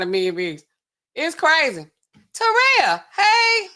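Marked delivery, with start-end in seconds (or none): 2.3-3.7 loud, high call across the room. Real, not acted. Calling out to Terrell to get his attention, loud high call.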